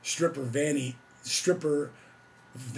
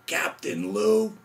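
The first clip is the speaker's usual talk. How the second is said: slow, high and loud "Captain Lou?"; amazed disbelief; 7 semitones higher, 6 dB louder, much slower than usual. Retold or imitated. A young fan hearing his hero will be on, stunned and loud. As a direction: gasp out the name like a starstruck fan. Thrilled at the name.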